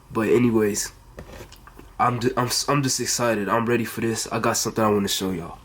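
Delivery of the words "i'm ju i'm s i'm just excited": ordinary talk, nothing marked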